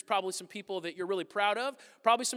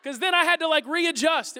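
mock anguish; 8 semitones higher, 9 dB louder, slower than usual. Real, not acted. Exaggerated complaint about moving.

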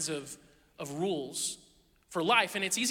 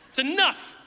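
louder, higher playful fed-up scolding; a tired dad ending bedtime with a grin; mock exasperated parent; 5 semitones higher, 5 dB louder than usual. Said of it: "I'm like".

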